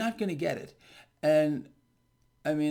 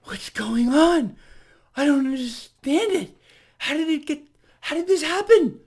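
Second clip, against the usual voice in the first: rising higher and louder, hammed-up bewilderment; mock baffled commentators; 8 semitones higher, 7 dB louder than usual. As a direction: ape the panicked TV pundits, climbing. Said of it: "they're like".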